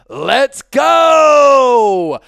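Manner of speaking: loud, high, stretched cheer; celebratory shout. Real, not acted. Host cheering a Super Chat, loud celebratory shout. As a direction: holler it with a long held GO.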